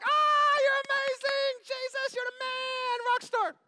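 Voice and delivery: very high screaming-fan cheer; mock adoring crowd. Preacher imitates people cheering Jesus, exaggerated high shriek. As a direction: squeal praise in a hammed-up high voice. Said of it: "People are like".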